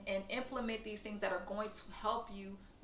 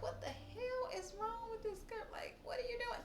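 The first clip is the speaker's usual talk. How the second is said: confused onlooker; 13 semitones higher, 9 dB quieter than usual. Retold or imitated. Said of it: "They're gonna be like".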